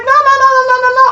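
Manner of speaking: emphatic denial. Retold or imitated. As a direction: shut them down fast.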